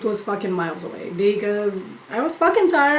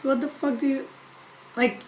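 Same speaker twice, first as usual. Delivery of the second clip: ordinary talk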